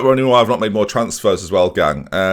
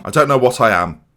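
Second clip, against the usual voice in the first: ordinary delivery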